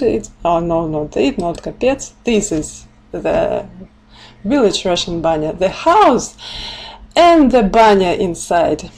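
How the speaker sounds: ordinary delivery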